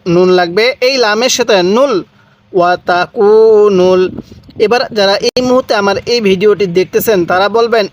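ordinary talk, nothing marked

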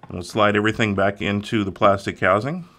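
usual speaking voice

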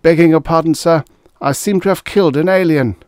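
put-on high, loud, nervous young officer; frightened. A narrator's reading. Frightened lieutenant confessing a killing to his commander, nervous. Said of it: "he said stammeringly".

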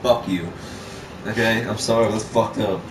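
ordinary talk

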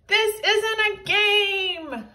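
high, loud, slow yell; villain's yell. A narrator's reading. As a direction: bellow it at the heroes. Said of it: "yelled Big Tippy".

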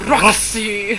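hammed-up stage delivery of the name; theatrical. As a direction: declaim it like an actor dog. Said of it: "They're very theatrical dogs".

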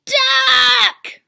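loud high furious yell; screamed. Acted. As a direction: scream the duck's name in fury. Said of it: "screamed Farmer Brown".

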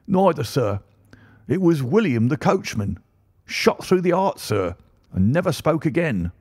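high-pitched, agitated servant's voice; shaken butler. Acted. Butler tells the Colonel who was killed, agitated. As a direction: report it urgently, pitch leaping up.

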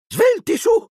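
very high motherly call; high, sharp holler; urgent hurrying. A narrator's reading. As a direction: chivvy the children aboard. Mother hurries children onto train.

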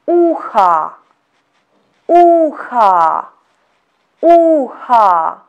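uha with the first O said as a; Russian uha. Shown as a demonstration. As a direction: uha said slowly as a-ha, unstressed o like a. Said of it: "the next word is".

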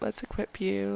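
usual speaking voice

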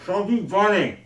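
cheeks stuffed full. Real, not acted. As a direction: mouth crammed with marshmallows.

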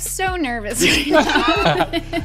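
slow high giggly nervous voice; nervous girl voice. Pretend. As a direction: play it shy and giggly. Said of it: "it would be weird if I was like".

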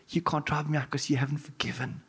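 hushed sneering demon voice; evil demon whisper. Retold or imitated. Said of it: "But this evil voice".

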